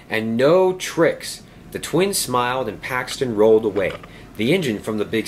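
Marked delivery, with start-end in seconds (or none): 0.1-1.4 drawn-out playful warning; slow warning. Acted. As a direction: stretch it out, mock stern. Warning twins.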